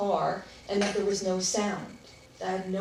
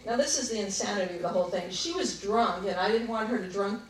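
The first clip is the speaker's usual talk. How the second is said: usual speaking voice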